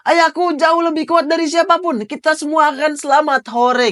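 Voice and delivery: put-on high, bright child's voice boasting about his dad; proud child. Child telling his friends their father will save them, high and proud. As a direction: sound like a small boy certain his father will save everyone.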